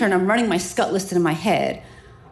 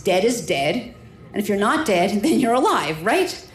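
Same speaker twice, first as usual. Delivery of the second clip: ordinary talk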